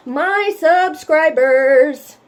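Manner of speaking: very slow, high and loud reveal; drawn-out announcement. Real, not acted. Host revealing to viewers who else she loves, drawn-out. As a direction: stretch it out like a grand affectionate reveal. Playful build-up to subscribe plea.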